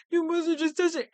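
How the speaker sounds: imitating the suspect's panic rising; panicking harder. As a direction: blurt the denial again in a rush. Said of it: "This guy is panicking".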